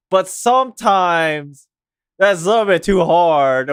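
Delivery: ordinary delivery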